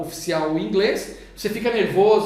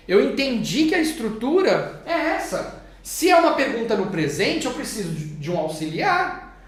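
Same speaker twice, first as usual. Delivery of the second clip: usual speaking voice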